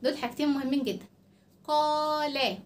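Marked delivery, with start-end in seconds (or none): 1.6-2.7 "قال" with a drawn-out alif; long madd sound. Shown as a demonstration. Teacher modelling for repetition, long vowel held. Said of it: "سمعنا صوت طويل".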